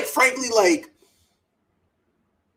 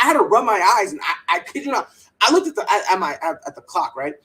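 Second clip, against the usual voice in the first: ordinary delivery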